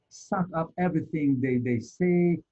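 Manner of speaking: normal talking voice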